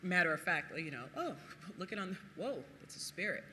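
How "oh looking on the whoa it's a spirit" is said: a hint of mild, soft, unbothered remark; calm mock observation. Disciples mocked as calmly noting a spirit, mild. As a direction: say it casually, as if unimpressed. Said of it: "I don't think they said that".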